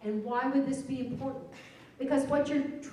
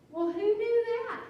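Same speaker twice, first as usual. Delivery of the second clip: much higher, slow "who knew" wonder; mock amazement; 11 semitones higher, 3 dB louder than usual. Teacher marvelling to colleagues at the accent rule, mock amazed. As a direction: act delighted by the discovery.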